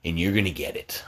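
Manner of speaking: low, hushed threat; menacing whisper. Acted. Parent threatening payback on kid, menacing whisper. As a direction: whisper it like a promise of revenge.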